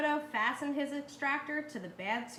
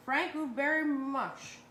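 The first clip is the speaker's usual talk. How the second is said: slow, higher, pushed through shut jaws; through clenched teeth; 4 semitones higher, 3 dB louder, slower than usual. A narrator's reading. Trapped fox thanks the dentists through clenched teeth. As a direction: speak with jaws glued shut. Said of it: "through his clenched teeth".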